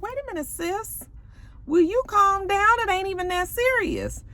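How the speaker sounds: lightly mimicked high-voiced peacemaker; Bucky. Vlogger imitating castmate telling another to calm down, high mock placating. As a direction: pitch it up, drawing out the middle.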